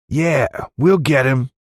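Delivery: high western voice; eager tall sidekick. A narrator's reading. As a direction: chime in keen for revenge. Tall man backs the giant.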